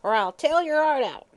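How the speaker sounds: put-on old hag drives the child away; croaking hag. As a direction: spit it out like an old witch. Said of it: "croaked the old hag".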